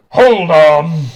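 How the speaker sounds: loud booming call. Acted. Amerigo's voice booming to silence the arena. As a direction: boom it to silence the crowd. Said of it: "They're kinda goes".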